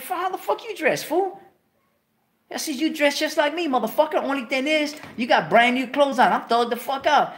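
high, loud, mock-indignant comeback; cocky retort. Young homie talks back to Steve, high and cocky. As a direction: fire back at him with playful outrage.

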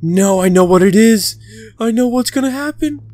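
real panic. Real, not acted. Panics at monster.

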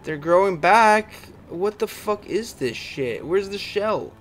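high, loud, drawn-out disbelief; outraged. Real, not acted. Player seeing targets grow back, outraged.

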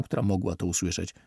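usual speaking voice

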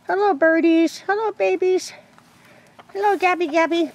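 high, sing-song greeting to the birds. Real, not acted. Woman greeting her chickens in a high cooing voice.

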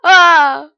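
loud panicked "ah, ah"; startled shout. Real, not acted. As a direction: yelp in alarm. Nearly falling in game.

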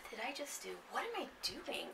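breathy hushed whisper of dismay; whispered self-reproach. Retold or imitated. Student realising a mistake, whispering to himself. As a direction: whisper it to yourself, caught in the act.

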